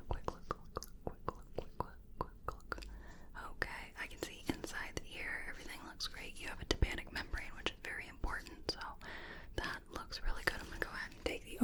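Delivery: doctor whispers during the ear check, very softly; faint close whisper. Acted. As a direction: breathe the words into the ear.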